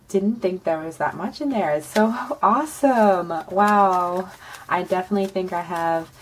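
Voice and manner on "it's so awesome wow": excited. Real, not acted. Thrilled at savings total.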